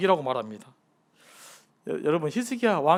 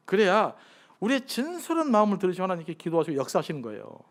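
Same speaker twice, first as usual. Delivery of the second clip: ordinary talk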